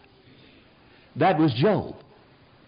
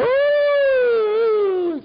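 high mock mourning howl; imitating a high mourning howl; imitated wail; 4 dB louder than usual. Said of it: "they begin to go".

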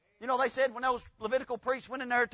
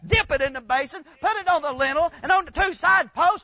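loud, rising revival preaching; fiery preaching; 5 semitones higher, 6 dB louder than usual. Acted. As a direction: preach it at full force to the congregation. Preaching at full force.

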